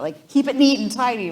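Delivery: put-on sharp, loud, high scolding of a kid; mock scolding. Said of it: "I'm like".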